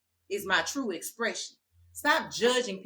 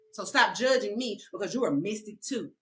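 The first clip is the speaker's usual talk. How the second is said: ordinary delivery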